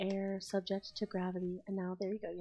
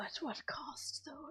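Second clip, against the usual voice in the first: slow breathy whisper, higher; hushed ominous whisper; 7 semitones higher, 5 dB quieter, slower than usual. Pretend. Dramatic repeat.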